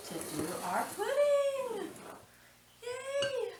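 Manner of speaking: high, slow sing-song with a squealed yay; delighted high-pitched yay; gleeful announcement. Real, not acted. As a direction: cheer the pudding in like a treat.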